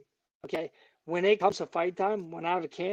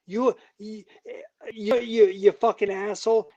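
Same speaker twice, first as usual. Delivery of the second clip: angry; 3 semitones higher, 6 dB louder than usual. Real, not acted. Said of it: "most heated guest ever".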